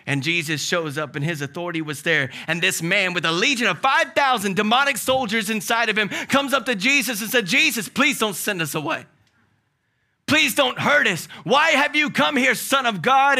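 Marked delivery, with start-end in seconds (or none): 2.5-7.4 higher, louder fervent preaching build; full-force preaching. Acted. 7.4-13.4 high, loud pleading demon voice; begging demon. Acted. Demons beg Jesus in story.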